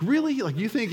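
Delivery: high, loud mock incredulity; mock disbelief. Husband needling his wife, mock incredulous and high. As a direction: needle her with a fake shocked Really. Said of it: "and I'd go, like".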